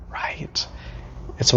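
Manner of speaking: whisper. Real, not acted. Whispered aside.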